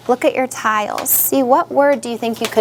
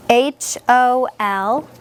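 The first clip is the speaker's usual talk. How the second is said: "H O L" letter by letter, slowly; name each letter of "H O L" with space between; spelling out; much slower than usual. Shown as a demonstration. Teacher spelling the syllable for the class, slow and separated. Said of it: "So the first syllable is".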